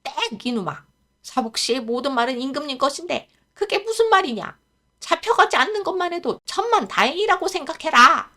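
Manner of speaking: put-on old groom telling the boy off; scolding. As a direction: lecture the foolish boy sharply. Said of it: "꾸지람을".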